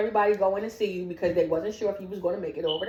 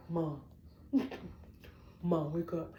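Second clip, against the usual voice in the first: softer, lower call to Mom; hushed wake-up plea; 5 semitones lower, 11 dB quieter than usual. Retold or imitated. Child to mother in a coma, hushed wake-up plea. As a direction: quietly imitate a child rousing Mom.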